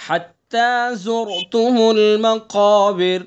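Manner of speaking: very slow chant, heavy ق in المقابر; teacher recites for the class; Quranic recitation. Shown as a demonstration. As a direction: stretch each word as a model. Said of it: "قاف کو کیسا پڑھیں گے؟".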